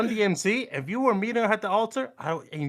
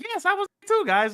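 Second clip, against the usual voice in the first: eager younger cousin; 11 semitones higher than usual. Retold or imitated. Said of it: "and he, like".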